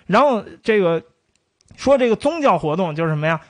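usual speaking voice

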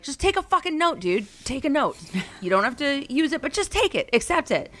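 high-pitched, exasperated plea; exasperated urging. Retold or imitated. Improv teacher telling her son to take criticism, exasperated. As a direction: urge it insistently, at the end of your patience. What she wanted to shout at son.

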